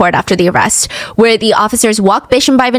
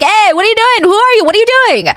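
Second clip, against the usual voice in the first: police yelling; 12 semitones higher than usual. Retold or imitated. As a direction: shout it up the stairs like a startled cop. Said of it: "The police are screaming after him".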